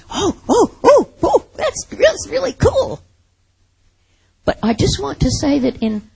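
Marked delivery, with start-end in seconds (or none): 0.1-3.0 high, thin gushing "oh, oh" of fake wonder; mock delight. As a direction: gush like a shallow fan, pitched way up. Mocking shallow believers' delight.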